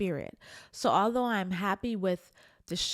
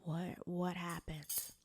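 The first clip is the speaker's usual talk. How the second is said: slow, barely voiced, softer whisper; sad whisper; 10 dB quieter, slower than usual. Voicing her own sadness to viewers in a whisper. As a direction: let the question fall away into a hush. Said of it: "sometimes I get sad because I'm just".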